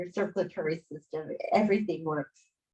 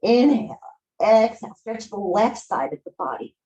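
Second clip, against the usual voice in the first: guided breathing; 4 semitones higher than usual. Acted. Yoga teacher guiding the class through a three-part breath, soothing.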